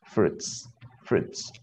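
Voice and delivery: "fruits" as "froots", no i sound; silent i. Shown as a demonstration. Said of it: "la I no se pronuncia aquí".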